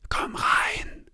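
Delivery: soft unvoiced plea; urgent whisper. A narrator's reading. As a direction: whisper it quickly, nervous. Nervous whisper through door. (hastig geflüstert)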